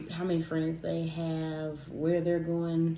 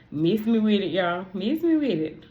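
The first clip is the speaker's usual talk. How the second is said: louder, sassy dismissal; sassy refusal; 4 semitones higher, 7 dB louder than usual. Real, not acted. Telling viewers she refuses seafood, sassy. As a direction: wave it away with attitude.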